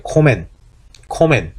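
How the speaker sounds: "코멘" with a short, single m, no doubled consonant; German short vowel before mm, single m sound. Shown as a demonstration. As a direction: say "코멘", not 콤멘. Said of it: "콤멘 하는 게 아니고 코멘이죠".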